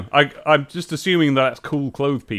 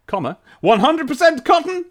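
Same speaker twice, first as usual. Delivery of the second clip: put-on old prospector; 8 semitones higher than usual. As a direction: read it like a crusty gold prospector. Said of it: "You gotta put on your old prospector voice".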